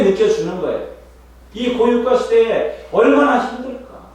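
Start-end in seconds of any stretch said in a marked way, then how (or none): 1.5-4.1 high, loud and slow, pleading with the crowd; impassioned cry. Acted. Preacher crying out to his congregation, loud and slow. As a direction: cry it out slowly, heart aching for them.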